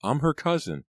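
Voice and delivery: high, anxious admission; nervous stammer. A narrator's reading. Captive explains herself to kidnappers, nervously. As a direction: admit it nervously, pitched high. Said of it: "Pam stammered".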